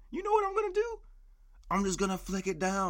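high, smug announcer voice; mock-proud. Ranting podcaster boasting about flicking the seat, high and smug. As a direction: announce it like a hero.

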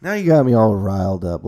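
usual speaking voice

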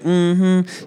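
sassy Southern nurse's mm-hmm; southern accent. Retold or imitated. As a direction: hum it knowingly with a Southern lilt. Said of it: "southern accent".

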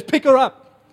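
loud, high order to pick her up; firm command. Retold or imitated. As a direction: bark the order to the crowd. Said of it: "He says".

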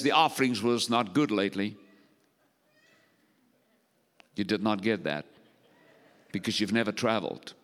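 low, soft, understated joke; deadpan aside. Real, not acted. Preacher slips a joke to the congregation, low deadpan aside. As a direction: drop the voice for a dry aside.

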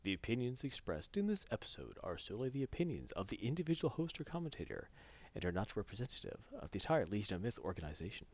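formal announcer read; disclaimer read. Acted. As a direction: read it like a legal notice.

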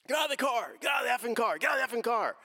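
high-pitched, urgent mock yell of an order; acted police command. Officer at gunpoint ordering a driver out of the car, urgent yelled command. Officer with gun in retold arrest.

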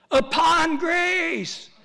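booming, very slow, high voice from above; voice of God. Pretend. God answers the drowning believer, booming slow. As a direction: call it out like a voice from heaven. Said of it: "we hear this voice".

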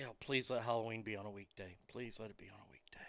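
fast pleading whisper. Real, not acted. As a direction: whisper it like a prayer.

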